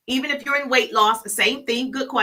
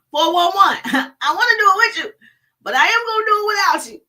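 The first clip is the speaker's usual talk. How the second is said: higher, louder, racing to the finish; fired-up quote; 9 semitones higher, 6 dB louder than usual. Retold or imitated. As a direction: shout it like a pumped-up motivator. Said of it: "I think Ray Higdon said that".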